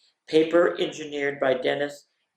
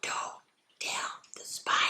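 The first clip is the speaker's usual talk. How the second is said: soft unvoiced whisper; breathy secret whisper; 12 dB quieter, slower than usual. Shown as a demonstration. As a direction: hush it like a secret. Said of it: "So you get to whisper it".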